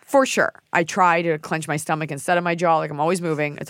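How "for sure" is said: higher, louder repeat; emphatic. Real, not acted. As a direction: say it again with more punch. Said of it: "repeats louder higher".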